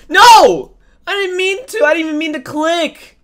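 high, loud frantic protest; panicked shout. Real, not acted. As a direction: yell at your own mistake. Panicked misclick outburst.

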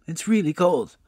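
lightly voiced helpful old lady; kindly old woman. A narrator's reading.

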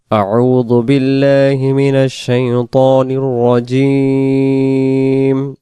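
slow, drawn-out chanted tilawat; Quran recitation. Acted. Teacher opens the lesson with slow melodic Quran recitation. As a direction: recite slowly with melodic long vowels.

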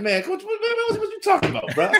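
very high squeal through laughter; mock outrage cracking into laughs; high-pitched laughing protest; laughing disbelief. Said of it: "I was excited".

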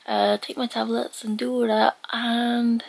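usual speaking voice

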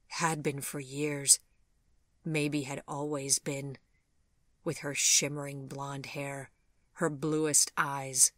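plain reading voice

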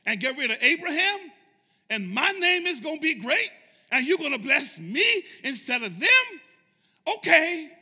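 high-pitched, questioning lilt with rising phrases; tempted mock disbelief. Preacher imagining himself offered Abraham's place, mock disbelief. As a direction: play at being flattered by God's offer. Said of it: "And I'd be like this".

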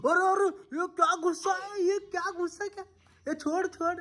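very high, playful squeaky voice; high cooing mock astonishment. Real, not acted. Father teasing a cross baby, very high baby talk.

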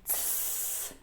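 «цс» as a voiceless hiss through closed teeth, palm at mouth; unvoiced ts hiss. Shown as a demonstration. Teacher demonstrating to children, voiceless «цс». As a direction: breathe out «цс» through clenched teeth, no voice. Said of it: "вимовимо".

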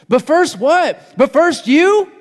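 incredulous preacher's retort; mocking incredulous challenge. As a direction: throw the excuse back in their face. Pastor mocks the excuse.

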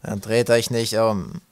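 loud creature's warning, slowing at the end; loud warning, last words drawn out; calling back. A narrator's reading. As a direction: call the orders back to the group. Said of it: "rief Ratz zurück".

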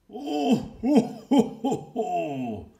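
high, loud, hearty ho ho ho; Santa laugh. Acted. Santa bursts in laughing, loud and jolly.

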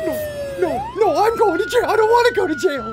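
high, loud pleading; panicked protest. Real, not acted. Player facing jail in the game, panicked high protest. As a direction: plead loudly as you're dragged off. About to be jailed.

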